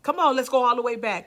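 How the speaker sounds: ordinary talk, nothing marked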